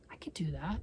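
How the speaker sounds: tempted soft whisper. Real, not acted. Tempted aside.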